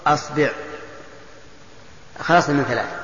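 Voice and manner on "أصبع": "أصبع" said as asbi', a then i; opening a, middle i. Shown as a demonstration. Teacher lists variants for students, "أصبع" as asbi'. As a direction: say "أصبع" with fatha on hamza, kasra on ba. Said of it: "والكسر".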